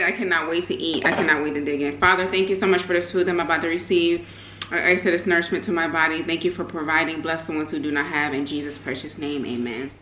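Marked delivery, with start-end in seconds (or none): 2.0-9.9 prayer. Real, not acted. Says grace before eating.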